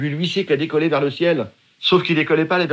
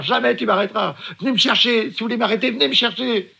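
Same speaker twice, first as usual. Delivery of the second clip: much higher mock howl of defiance; defiant yell; 8 semitones higher than usual. Swimmer howling into the sea, higher defiant cry. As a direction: dare them to come get you. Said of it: "Je hurle dans la mer".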